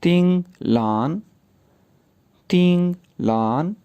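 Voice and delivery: तिंग लान said slowly, rising on तिंग; slow careful तिंग लान; name demo. Shown as a demonstration. Teacher teaching the name तिंग लान, slow and careful. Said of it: "नामों को pronounce करना सीखते हैं".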